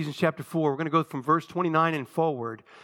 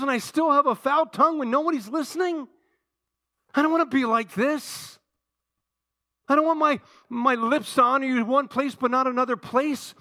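high, loud, pleading sermon voice; impassioned preaching; 11 semitones higher, 6 dB louder than usual. Real, not acted. Preacher acting out his own desperate prayer to God, impassioned preaching. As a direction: cry out to God in full preaching voice.

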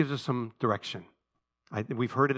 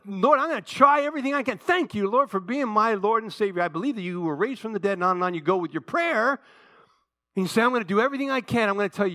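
high, driving, loud sermon delivery; full-force preaching; 8 semitones higher, 5 dB louder than usual. Real, not acted. Preacher warning church that willpower will fail, full-force preaching. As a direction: preach it hard with raised pitch.

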